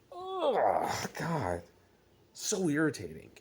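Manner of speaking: high anguished oh, then sigh; exasperated groan. Real, not acted. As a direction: groan in real frustration. Frustrated rant about bullpen.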